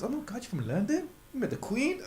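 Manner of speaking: hammed-up starstruck questions, breaking into laughter; gushing American fan. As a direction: play an awestruck American, questions lifting up. Imitates Americans marvelling at Londoner.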